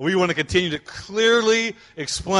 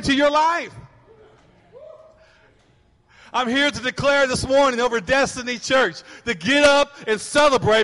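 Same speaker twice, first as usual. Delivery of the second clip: full-force preaching; 6 semitones higher than usual. Real, not acted. Pastor declaring joy over the congregation, full-force preaching.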